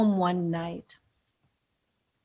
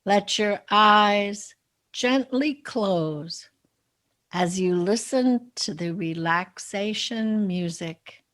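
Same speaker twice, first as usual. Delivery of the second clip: unhurried meditation guide, softening at phrase ends; guided meditation; 5 dB quieter than usual. Acted. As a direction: lead listeners gently into relaxation, with long pauses. Leads a guided visualization.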